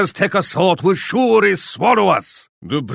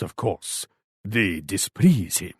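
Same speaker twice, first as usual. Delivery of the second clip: dropped low and much softer, a quiet threat; menacing hush; 9 semitones lower, 8 dB quieter than usual. Acted. Warlord hinting at what befalls disloyal allies, low quiet menace. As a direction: let the threat sink into a near-hush. Veiled threat after loyalty claim.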